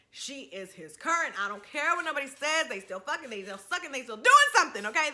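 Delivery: outraged rant. Real, not acted. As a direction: yell the advice at her like a fed-up friend. Storyteller rants warning at listener.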